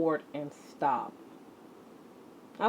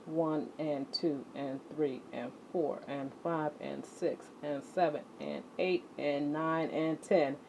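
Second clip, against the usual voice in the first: counting along; 3 semitones lower, 9 dB quieter than usual. Acted. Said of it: "counting the skips".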